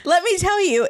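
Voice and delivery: normal talking voice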